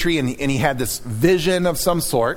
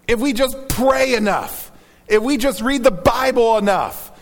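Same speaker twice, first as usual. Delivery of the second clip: rising sermon preaching; 4 semitones higher than usual. Acted. Pastor urging the church, fervent preaching. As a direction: press the point home like a preacher.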